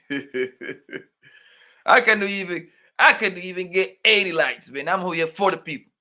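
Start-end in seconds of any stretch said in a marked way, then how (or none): 0.1-4.7 laughing through the words, slow at the start; half-laughing complaint about likes; laughing as he repeats the line; laughing disbelief. Real, not acted.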